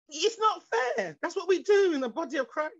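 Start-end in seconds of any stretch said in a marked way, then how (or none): 0.1-1.2 higher, drawn-out mock protest; mock complaint. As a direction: play a sulky body part moaning about unfairness. Acting out a complaining body part.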